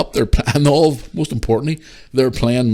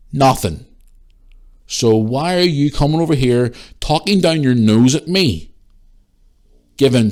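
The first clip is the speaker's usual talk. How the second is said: louder, heated challenge to a nosy stranger; angry confrontation; 5 semitones higher, 6 dB louder than usual. Retold or imitated. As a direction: push back hard at her, building to a shout. Retold row with the stranger.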